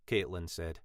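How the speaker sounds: plain reading voice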